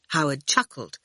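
ordinary delivery

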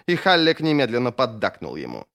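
plain reading voice

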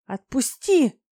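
loud, high cry of protest; angry demand. A narrator's reading. Dragoness kicking at the dragon holding her, angry shout. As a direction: yell to be let go.

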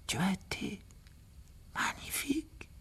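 faint, slurred whisper; dying boy barely gets it out; thick weak whisper. A narrator's reading. Wounded Harry to the phoenix, a thick whisper. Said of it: "d'une voix pâteuse".

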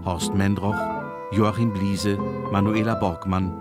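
usual speaking voice

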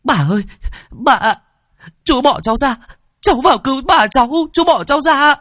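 put-on very high, desperate child's scream. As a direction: scream to be let go, frantic and loud. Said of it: "Tôi gào ầm lên".

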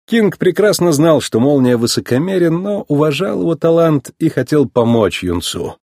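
ordinary delivery

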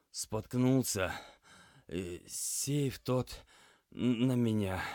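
slow, halting, weak croak; feeble, broken account through pain; hoarse injured patient. A narrator's reading. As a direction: labour out each phrase, pausing between words. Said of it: "парень хриплым голосом".

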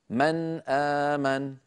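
slow "من آمن" with a clearly sounded noon; clear izhar recitation. Shown as a demonstration. Teacher demonstrates noon before hamza, clear izhar recitation. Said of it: "إظهار النون عند الهمزة".

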